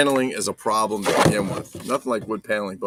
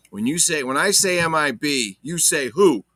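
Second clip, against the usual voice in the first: call and response; 5 semitones higher, 5 dB louder than usual. Acted. Call-and-response chant to viewers.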